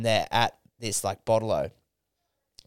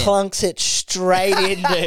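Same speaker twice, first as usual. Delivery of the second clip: laughing; 7 semitones higher, 5 dB louder, much slower than usual. Real, not acted. Punchline, laughing delivery.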